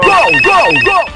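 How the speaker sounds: goal scream. Real, not acted.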